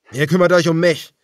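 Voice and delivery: shouted dare; defiant shout. A narrator's reading. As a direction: challenge them to come at him. Daniel shouting at the cannibals.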